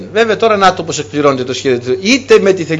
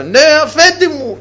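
bowing servants; 10 semitones higher than usual. Retold or imitated. Brothers bowing before Joseph.

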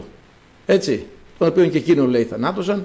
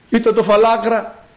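higher-pitched jeer at a bald man; mocking; 6 semitones higher than usual. Crowd laughing at the bald prophet, mocking. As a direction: taunt him, pitched up. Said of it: "Και γελάγανε και παίζανε".

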